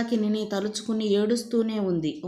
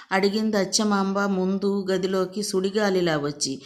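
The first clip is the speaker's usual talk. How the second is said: ordinary delivery, nothing marked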